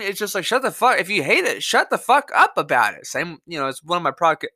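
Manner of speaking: normal talking voice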